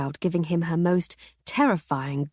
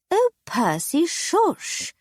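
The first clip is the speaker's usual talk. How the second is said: much higher, hushing wife voice; high shushing wife; 12 semitones higher, much slower than usual. Acted. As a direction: shush him, high and anxious.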